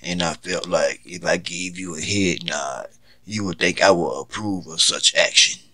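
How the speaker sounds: calm menacing. Acted. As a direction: explain it coldly and calmly.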